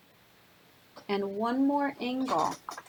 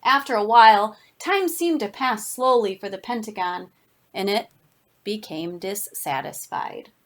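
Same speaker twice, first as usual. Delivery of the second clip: ordinary delivery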